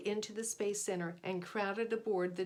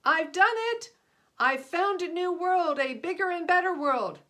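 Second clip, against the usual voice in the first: loud, high triumphant shouting; excited shout; 9 semitones higher, 11 dB louder than usual. A narrator's reading. Spaceman hopping out to tell the chief, shouting with joy. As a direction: burst out with the good news.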